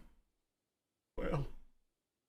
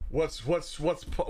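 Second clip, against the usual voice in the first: normal talking voice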